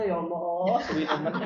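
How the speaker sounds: put-on exasperated moan; mock weary complaint. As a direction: grumble like a fed-up worshipper. Mimics complaint about long prayer.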